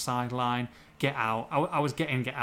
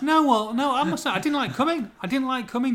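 much higher, insistent confession; emphatic protest; 9 semitones higher, 4 dB louder than usual. Real, not acted. Visiting fan admits disliking the trip, emphatic protest. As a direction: protest it, pitch jumping up. Joking objection to coming to Molineux.

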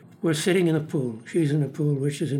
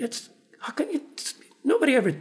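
put-on much higher, sputtering indignant old lady; outraged sputter; 11 semitones higher, faster than usual. Lillian sputtering at the producer's insult, outraged and high. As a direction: splutter it out in shocked offense.

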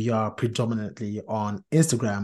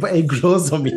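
laughing; 6 semitones higher, 8 dB louder than usual. Real, not acted. Echoes co-host's remark while laughing.

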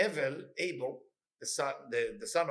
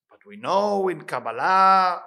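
emphatic; 4 semitones higher, 12 dB louder, much slower than usual. Real, not acted. Teacher stresses a key point.

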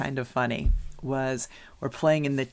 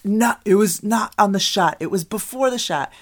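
referee's retort; 7 semitones higher, 8 dB louder than usual. Retold or imitated. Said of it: "And he's like".

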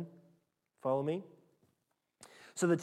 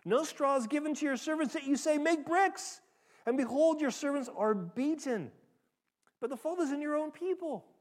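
much higher, pleading cry; desperate plea; 10 semitones higher than usual. Acted. Israel's foremen crying out to Pharaoh, high desperate pleading. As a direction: beg the king for mercy. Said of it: "came and cried to Pharaoh".

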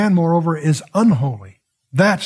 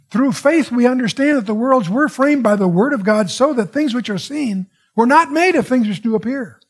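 lifted, higher reading voice for the verse; scripture reading; 7 semitones higher than usual. Acted. Preacher reading Hebrews to the congregation, raised scripture voice. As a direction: read it out like holy writ, pitched up.

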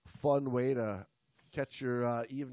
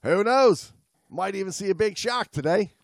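higher, louder cheerful diver's thought; voiced diver's thought; 6 semitones higher, 6 dB louder than usual. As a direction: toss off the diver's musing brightly.

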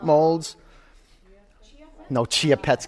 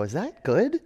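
put-on higher, small voice of a six-year-old asking; little boy voice; 5 semitones higher than usual. Six-year-old son asking his father about painting, innocent small voice. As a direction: ask it like an unsure little kid. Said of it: "I go".

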